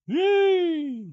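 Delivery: high, loud yay; loud gleeful cheer; happy cheer. Real, not acted. Delighted at cat room.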